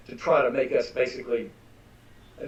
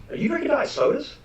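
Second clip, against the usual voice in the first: incredulous question; 14 semitones higher than usual. Retold or imitated. Telling a pastor friend his soda habit, retold incredulous high question.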